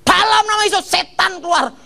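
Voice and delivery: imitating a preacher casting out a demon, pitched very high; high, forceful mock deliverance cry; retold exorcism command. Acting out deliverance prayer over him. (tiruan seruan mengusir setan, bernada tinggi)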